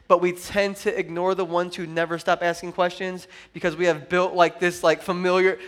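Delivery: usual speaking voice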